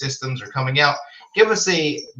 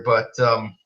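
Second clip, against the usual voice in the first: usual speaking voice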